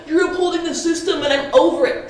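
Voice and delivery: exaggerated mock protest; mocking imitation. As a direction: mimic the slogan back scornfully. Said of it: "So this whole".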